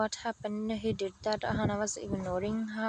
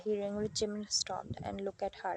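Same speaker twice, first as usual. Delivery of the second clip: ordinary delivery, nothing marked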